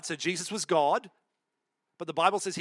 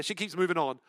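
ordinary delivery

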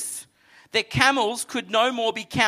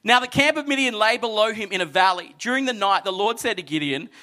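ordinary talk, nothing marked

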